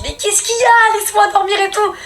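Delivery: high, whiny mock grumble of a woken sister; annoyed sister. Woken sister grumbling at her, high whiny voice. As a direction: play the sister groaning to be left asleep.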